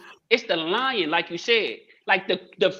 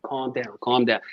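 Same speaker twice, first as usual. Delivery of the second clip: calming himself; 10 semitones lower, 3 dB quieter than usual. Retold or imitated. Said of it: "so I could calm down".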